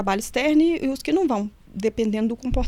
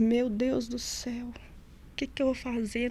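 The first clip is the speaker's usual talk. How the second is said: slow, soft, worried plea; anxious prayer; 8 dB quieter, slower than usual. Retold or imitated. Guard alone in the bathroom praying, slow soft worry. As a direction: murmur it slowly to God in panic.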